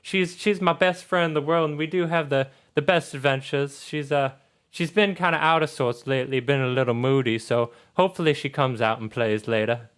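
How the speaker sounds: put-on sweetly sociable girl's voice; friendly Charlotte. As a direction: gush like a daughter about her best friend. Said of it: "so she's like".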